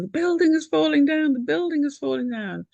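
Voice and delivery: high-pitched frightened child, imitated; retold panic. Mother retelling her daughter's nightmare, high mock panicked cry. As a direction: mimic a scared child crying out the alarm. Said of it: "Gina started screaming".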